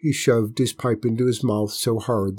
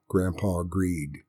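usual speaking voice

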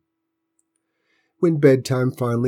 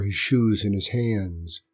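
ordinary delivery

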